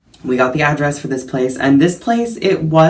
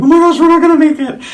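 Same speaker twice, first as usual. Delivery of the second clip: loud, high, rushed mock squeal; retold panic; 9 dB louder, faster than usual. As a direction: act out a hammed-up panic in one fast burst. Retold panic on winding road.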